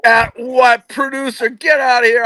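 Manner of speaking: scoffing at the job title; incredulous. Real, not acted. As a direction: throw the question back in disbelief. Repeats question, incredulous.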